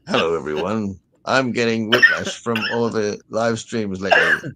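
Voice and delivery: lightly put-on American accent; a slow, hammed-up North American accent; mock American accent. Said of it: "he's got an American accent".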